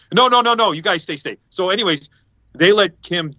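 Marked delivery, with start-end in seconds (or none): 0.1-1.4 insistent refusal. Retold or imitated. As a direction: imitate an eager host insisting. Band insisting they stay.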